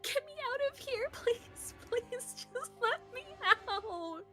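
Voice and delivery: high, loud frantic pleading; desperate screaming. Acted. June flailing at the door to escape, desperate high scream. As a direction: beg in a mindless, desperate cry. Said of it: "a desperate, mindless cry".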